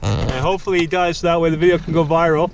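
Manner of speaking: loud, high-pitched, thrilled commentary; excited. Real, not acted. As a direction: call it out eagerly. Watching geese chase John.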